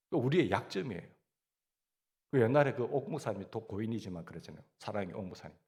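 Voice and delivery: ordinary talk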